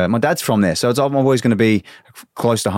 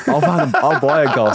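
laughing; 6 semitones higher than usual. Real, not acted. Desperate for goal, laughing.

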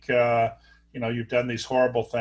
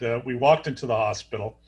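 ordinary delivery

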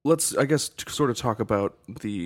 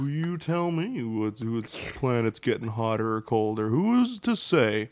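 American politician voice. Retold or imitated. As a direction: play a dodging American senator. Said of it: "I love your American politician voice".

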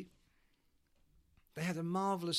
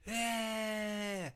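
high, long-held crowd yeah; crowd reply; 7 semitones higher than usual. Acted. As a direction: stretch it out like a distant audience.

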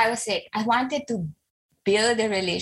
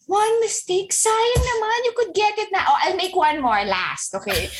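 pushy mom; 10 semitones higher than usual. Retold or imitated. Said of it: "I didn't realize I was already shouting".

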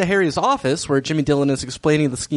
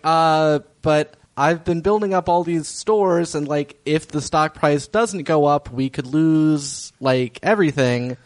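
slow worried plea; slower than usual. Retold or imitated. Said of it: "[name]'s like".

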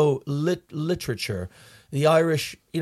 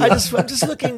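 much higher, louder, giggling through it; laughing denial; 11 semitones higher, 5 dB louder than usual. Real, not acted. Host caught looking, defending herself laughing. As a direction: protest innocence while laughing.

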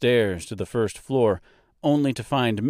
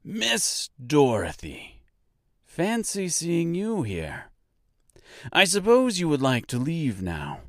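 sly Reginald; 7 semitones higher, slower than usual. A narrator's reading.

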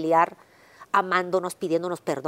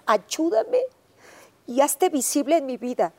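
pleading prayer; 6 semitones higher than usual. Retold or imitated.

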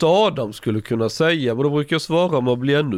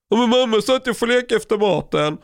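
a higher, put-on little boy's protest; whiny child voice; 9 semitones higher than usual. Mother voicing her son pleading for the tablet, whiny.

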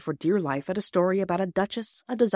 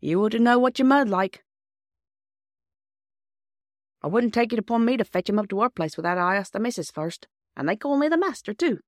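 higher-pitched rustic character voice; farm bailiff; 5 semitones higher, 4 dB louder than usual. Acted. Bailiff leaving the decision to the child, rustic character. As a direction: concede it like a countryman.

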